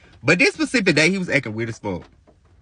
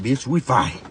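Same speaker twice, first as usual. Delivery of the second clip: normal talking voice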